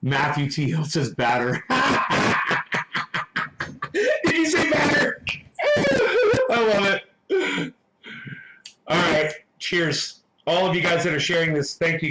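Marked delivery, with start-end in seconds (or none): 1.7-3.9 staccato, unvoiced cackle; burst of laughter. Real, not acted. 3.9-7.7 high, squealing through laughter; helplessly amused, pitch shooting up; real laughing delight, words drawn out; cracking up. Real, not acted. Cracking up over viewer's comment.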